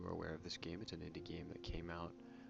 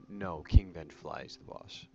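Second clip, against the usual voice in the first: sudden loud correction; 12 dB louder than usual. Real, not acted. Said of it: "Yeah, that's right".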